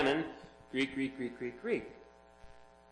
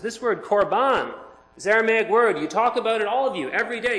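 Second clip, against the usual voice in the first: stern rebuke; 6 semitones higher, 6 dB louder than usual. Retold or imitated. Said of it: "He says".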